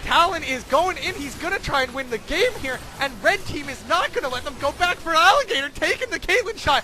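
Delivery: high, loud climactic casting; shouted play-by-play. Acted. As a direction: yell the game-winning moment. Climax of the game.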